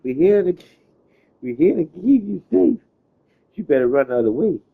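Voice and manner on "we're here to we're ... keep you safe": high, loud, put-on sweet official voice; sing-song the fake promise like a politician; mock official reassurance. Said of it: "Whenever you hear somebody saying that".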